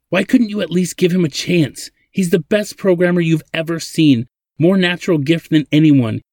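high, insistent indignant argument; angry. A narrator's reading. Zadeh defends Ladin to her father, angry. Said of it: "Zadeh said angrily to her father".

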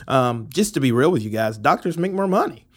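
ordinary talk

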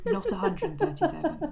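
real laughing tease. Real, not acted. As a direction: laugh out the punchline. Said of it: "Just to remind you".

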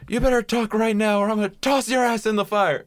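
high, shrill angry threat; angry mom threat. Retold or imitated. Mom threatens the Chucky doll.

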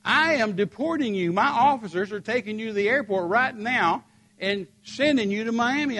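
lightly voiced angry lady official; indignant official. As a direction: press it like an irritated official. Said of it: "lady official insisting".

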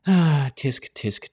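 normal talking voice

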